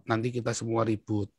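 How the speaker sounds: quiet, subdued fatherly warning; hushed retold counsel; father's advice. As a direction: voice a father softly cautioning his child. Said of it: "ayah saya selalu bilang apa".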